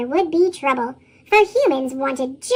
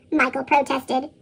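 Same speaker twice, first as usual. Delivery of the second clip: plain reading voice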